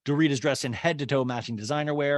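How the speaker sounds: ordinary delivery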